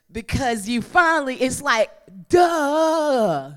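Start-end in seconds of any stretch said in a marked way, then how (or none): 2.3-3.5 loud high comic duh; slap-your-forehead duh; preacher mocking own blindness. Mock realization.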